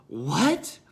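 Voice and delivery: gasp, then a loud rising question; mock shock with a sharp intake of breath; astonished. Monk watching the other break the rule, astonished. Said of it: "the other monk is just astonished".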